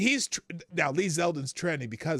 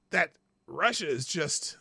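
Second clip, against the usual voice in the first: normal talking voice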